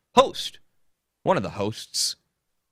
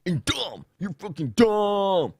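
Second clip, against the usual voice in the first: mocking Dave; 7 semitones higher, slower than usual. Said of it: "he likes to call people stupid".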